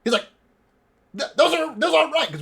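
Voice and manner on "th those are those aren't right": high, loud stammer; agitated mad voice; insane elf. Acted. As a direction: sputter it in frantic objection. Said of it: "fucking insane elf".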